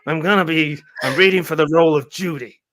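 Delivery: gruff cop voice auditioning; a hint of a tough cop reading; Dennis Franz voice. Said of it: "Can you imagine?".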